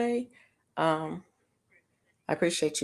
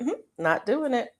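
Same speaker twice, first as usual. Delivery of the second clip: higher-pitched mock self-denial; self-talk refusal; 7 semitones higher than usual. Shopper telling herself not to cook for the holidays, playful refusal. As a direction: firmly talk yourself out of it, playfully. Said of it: "Kept saying to myself".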